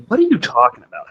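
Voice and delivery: high-pitched incredulous question; retold bafflement. Passenger questioning the driver's strange words, incredulous. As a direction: replay your baffled comeback, pitch jumping up.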